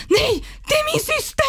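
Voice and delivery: very high panicky squeal; panicked denial. Retold or imitated. Caught by a fan, panicked high denial about her sister. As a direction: blurt it out in pure panic. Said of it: "jag får ju panik".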